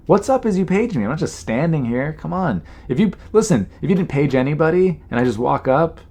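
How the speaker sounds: high-pitched indignant scolding; exasperated, pitch jumping up; worked-up angry rant. Retold or imitated. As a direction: tell off the agent as if he were here. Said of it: "now I'm just angry".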